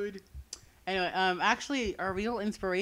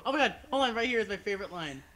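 ordinary talk, nothing marked

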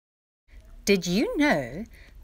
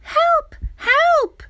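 very high, loud cry for help; frightened cry; 12 dB louder than usual. A narrator's reading. Dudu fleeing the crocodile, crying out in panic. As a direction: shriek for rescue like a scared child. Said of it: "Dudu cried".

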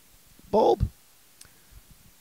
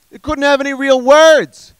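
loud, high outraged yell; exasperated shout; 8 semitones higher, 9 dB louder, slower than usual. Acted. Comic ranting about food app passwords, exasperated shouting. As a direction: yell it in disbelief, stretching the last words.

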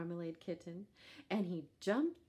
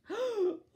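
sharp loud gasp; startled gasp; 9 dB louder than usual. A narrator's reading. Kitten shocked by the pig, loud gasp.

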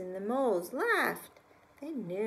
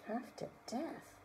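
soft, hushed half-whisper; spooky hush; 3 semitones higher, 9 dB quieter than usual. Acted. Reader repeating the bear's threat to the child, hushed. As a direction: savour the threat quietly. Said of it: "teller repeats line with relish".

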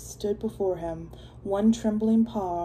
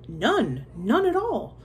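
python voice, crying out; 4 semitones higher, slower than usual. A narrator's reading. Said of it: "cried the python".

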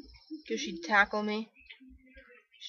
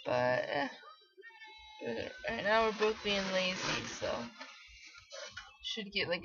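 usual speaking voice

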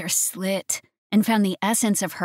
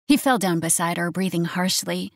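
usual speaking voice

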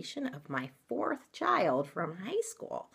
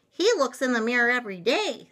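tattling sisters; 8 semitones higher, 6 dB louder, faster than usual. Acted. Storybook sisters tattle on brother.

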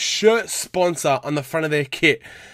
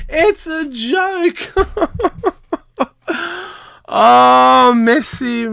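high, slow, half-laughing "It's a joke."; laughing in disbelief; 8 semitones higher, 4 dB louder, much slower than usual. Real, not acted. Ranting at the award.

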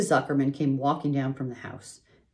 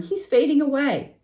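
high, loud alarmed cry; frightened girl shouting; screaming; 13 semitones higher, 7 dB louder than usual. A narrator's reading. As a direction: cry out that he's fading. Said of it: "screamed Fern".

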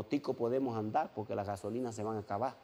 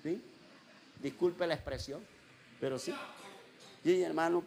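ordinary talk

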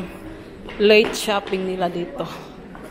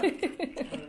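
quiet little laugh; soft laugh; 4 semitones higher, 12 dB quieter than usual. Real, not acted. Mother to kids, a quiet laugh. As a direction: let a soft laugh slip out.

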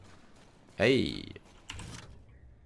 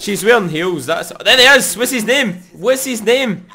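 much higher and louder, thrilled; excited shout; 12 semitones higher, 11 dB louder than usual. Real, not acted. Gamer spotting a familiar character, excited high shout. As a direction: call out in delighted recognition.

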